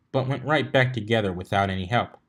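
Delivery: plain reading voice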